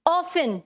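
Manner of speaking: often said as OFF-un, no T sound; often pronounced without its T; often with silent T. Shown as a demonstration. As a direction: say often like "awfun", the T left silent. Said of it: "क्या बोला जाता है".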